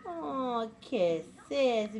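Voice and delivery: usual speaking voice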